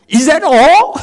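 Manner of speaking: high, laughing scoff; mock American scoff. Teacher playing the brash American asker, laughing scoff. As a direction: toss off the challenge with a laugh. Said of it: "It's so American".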